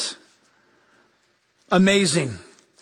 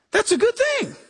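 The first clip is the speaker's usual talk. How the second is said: cheerful reply; 12 semitones higher than usual. Retold or imitated. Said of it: "And I go".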